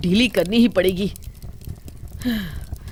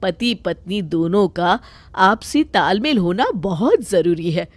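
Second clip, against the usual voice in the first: mother scolds son, protects daughter-in-law; scolding mother; 2 semitones lower than usual. Acted. (बेटे को समझाती माँ, नीची आवाज़)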